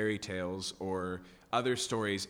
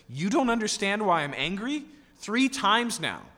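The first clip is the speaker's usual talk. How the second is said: higher, louder, slowing hard on three times now; angry retold outburst; 9 semitones higher, 7 dB louder than usual. Preacher voicing Balaam scolding his donkey, angry and loud. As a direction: rage at the donkey as Balaam would. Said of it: "He's so angry".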